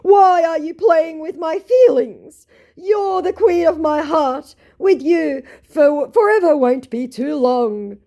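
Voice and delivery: high, loud, slow mock pleading; hammed-up romantic wail, every phrase drawn out; lovelorn scammer. Scammer begs her not to toy with him, mock lovelorn wail. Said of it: "my scammer then very promptly said".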